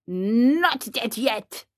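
slow, drawn-out echo; lingering, doom-laden creature voice; ominous rabbit. Acted. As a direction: intone it slowly like an undertaker rabbit.

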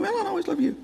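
high, soft mimicking voice; mocking echo. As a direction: parrot it back in a small, high voice. Mimicking echo of father's line.

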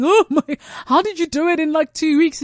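high, loud, over-the-top gushing; mock-gushing friend asking about fast weight loss; gushing mock amazement. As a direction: play the impressed friend who can't believe it.

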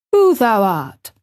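put-on lightly shaky old gardener; a touch of shock in the voice; queer, shaky voice. A narrator's reading. Stunned gardener answers the boy, mildly shaky. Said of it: "answer in a queer, shaky voice".

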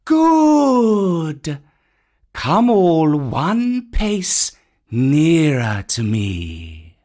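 slow, luring snake command; Kaa the python. Acted. Kaa drawing the monkeys nearer, slow hypnotic python.

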